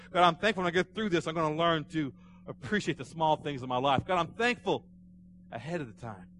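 loud, building declarations of thanks; fervent preaching. Shown as a demonstration. Preacher modelling thanks to God before the trial ends, fervent. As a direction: pile up thanks with rising force.

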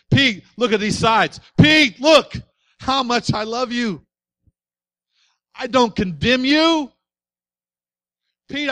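loud, pleading voice of Jesus showing his wounds; impassioned Jesus. Acted. Jesus to Pete, showing his wounds, impassioned and loud. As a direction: preach it hard, as Jesus begging Pete to look.

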